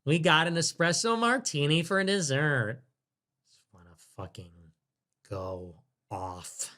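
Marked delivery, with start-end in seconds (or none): 3.5-6.7 much softer, fast muttering; muttered aside. Real, not acted. Streamer venting quietly to himself, muttered. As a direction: grumble it under your breath.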